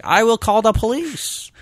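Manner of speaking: high, slow Russian lady threatening; mock Russian accent. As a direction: draw it out in a hammed-up Russian accent. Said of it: "It's very Russian".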